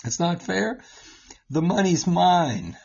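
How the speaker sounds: lightly hammed-up dad scolding; a hint of a mock-stern parent; mock-indignant parent. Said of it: "I would say to my kids".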